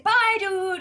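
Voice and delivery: high, loud, cheery send-off; triumphant goodbye. Retold or imitated. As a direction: sing it out as you bolt away. Escaping at the bus stop.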